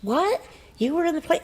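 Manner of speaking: high, baffled what; surprised question. Retold or imitated. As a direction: sound amazed she was in it. Receptionist surprised at narrator.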